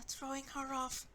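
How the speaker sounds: usual speaking voice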